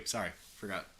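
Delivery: usual speaking voice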